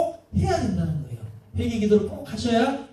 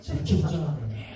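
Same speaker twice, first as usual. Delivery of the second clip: mock-pious mild complaint, very low and soft; acted sanctimonious murmur, much lower; holy restraint; 10 semitones lower, 7 dB quieter than usual. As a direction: drop the voice into hushed, saintly self-control. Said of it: "거룩하게".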